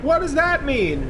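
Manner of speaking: put-on high, slow puzzled child's question; curious kid. Future kid asking about history, high and slow. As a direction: ask it like a baffled little kid. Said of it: "some kid will be like".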